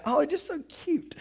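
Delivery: high, sugary mock coo; a light mock coo over cute cherubs; cooing over cute angels. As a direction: gush like someone cooing at a baby.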